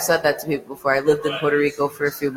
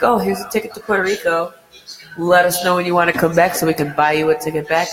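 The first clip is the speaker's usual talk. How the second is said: a hint of higher-pitched parent voice; lightly voiced parents; 3 semitones higher, faster than usual. Parents give a graduate a ticket, lightly voiced. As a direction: lightly imitate parents presenting a gift.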